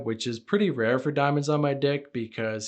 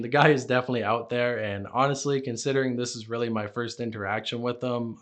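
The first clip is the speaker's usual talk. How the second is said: usual speaking voice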